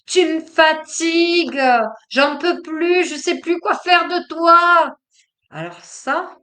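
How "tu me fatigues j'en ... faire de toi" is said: put-on loud, high, fed-up outburst at the child; slow, loud, exasperated complaint; exasperated reactive parent. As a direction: snap at the kid like a worn-out parent. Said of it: "le parent réactif pourrait dire".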